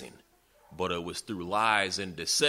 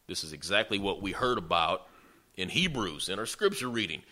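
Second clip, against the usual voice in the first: usual speaking voice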